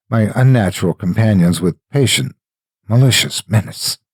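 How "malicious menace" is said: plain reading voice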